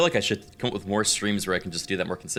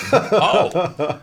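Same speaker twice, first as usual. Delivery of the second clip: laughing; 10 semitones higher, 7 dB louder than usual. Real, not acted. Reacting to Kraken joke.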